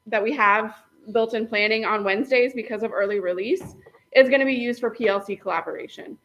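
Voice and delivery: usual speaking voice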